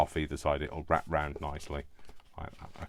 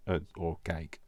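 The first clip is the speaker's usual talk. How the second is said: ordinary delivery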